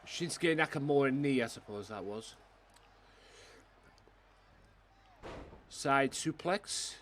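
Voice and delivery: live wrestling play-by-play; wrestling commentator. Pretend. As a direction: call each move as it lands. Calls the game moves live.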